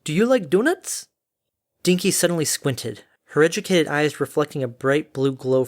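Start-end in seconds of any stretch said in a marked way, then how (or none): 0.0-1.1 high, cheery question; blithe Derpy. Acted. Derpy chats obliviously to oncoming Harmony, blithe.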